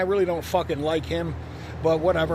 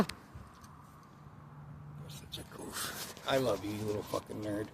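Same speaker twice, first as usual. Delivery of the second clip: soft, low, whispered affection; whispering love to the dog, soft and low; tender murmur to dog; 7 semitones lower, 13 dB quieter than usual. Real, not acted. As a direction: coo to your dog.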